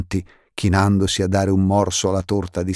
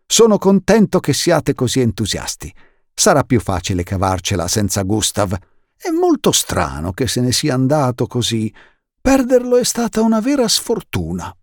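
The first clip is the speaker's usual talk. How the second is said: pleased, then a sigh for Gustav; cheerful uncle; 4 semitones higher than usual. Acted. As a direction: explorer uncle, glad then rueful. Uncle Monty, glad then regretful.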